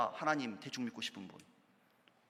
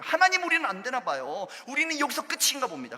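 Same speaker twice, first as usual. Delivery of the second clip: raised, higher cry of giving up; loud, higher plea of defeat; despairing cry; 7 semitones higher, 5 dB louder than usual. Acted. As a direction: cry out that it's over. People's despairing cry quoted.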